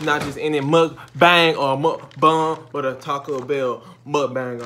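loud and slow, punching mukbang; excited emphasis. Real, not acted. Building up the mukbang.

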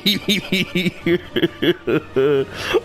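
long, tearful-sounding laugh; drawn-out giggling breakdown; overjoyed laugh. Real, not acted. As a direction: laugh helplessly in delight. Overjoyed at the game.

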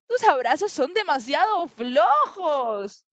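high, loud, slow protest; shouted complaint. Acted. Little leopard complaining about the monkey's hug, crying out. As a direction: cry out each word slowly. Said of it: "Grita Leopardito".

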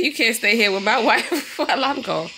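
quoting through giggles; laughing quote. Retold or imitated. Vlogger repeating the angry dad's warning, laughing. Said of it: "He said".

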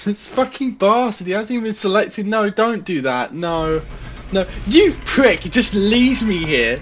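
high, loud furious cursing; swearing tirade. Real, not acted. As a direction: yell insults at your friend. Left alone, cursing friend.

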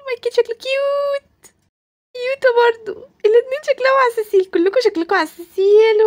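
very high, squealy cooing; high sing-song adoration; cutesy gushing. Acted. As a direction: fawn over cute little ones. Cooing over how cute everyone is.

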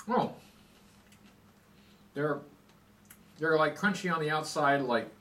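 talking between bites; chewing while talking. Real, not acted. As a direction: mouth full of candy. Seen 3: Puts candy in mouth at -4; jaw and cheeks chewing throughout.

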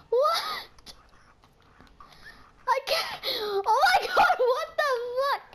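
high, breathless laughing words; hysterical laughter. Real, not acted. Cracking up at the opponent, words broken by high laughter. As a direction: talk through helpless laughter.

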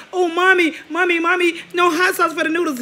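mock a child pleading with mommy; whining child. Roasting him as a kid missing hot sauce, high child whine.

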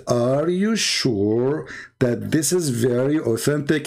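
deliberate, slowed-down cross-examining tone; slow pointed question. Retold or imitated. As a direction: draw each word out slowly. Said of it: "So I said".

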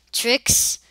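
calling a name loudly; called out. Real, not acted. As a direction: shout the name out.

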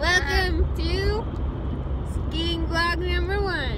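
high, loud and slow announcer voice; mock show host welcome; hyped announcement. Hammy vlog announcement.

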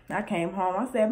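normal talking voice

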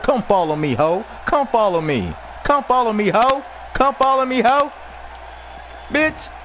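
rising taunt at the wrestler. Real, not acted. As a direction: goad the rival to follow. Taunting the opponent to follow.

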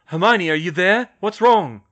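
anxious yell across a distance; urgent shouting. A narrator's reading. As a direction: shout it so it carries. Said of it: "shouted at the top of his lungs, hoping it would carry".

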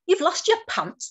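high-pitched storybook exclamation; pirates' cry. A narrator's reading. Pirates to Puffer about lost pants, astonished cry. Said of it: "the pirates cried".

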